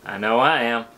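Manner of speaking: usual speaking voice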